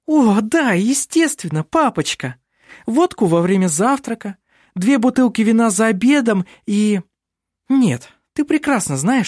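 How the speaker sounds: put-on high, bubbly girl voice; bright chatter of a lively girl; lively young woman voice. A narrator's reading. Vinga teasing Heike.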